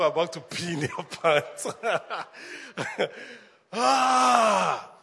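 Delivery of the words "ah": high, loud gleeful cry; laughing shout. Real, not acted. Pastor reacting to laughing crowd, gleeful cry. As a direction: let out a burst of glee.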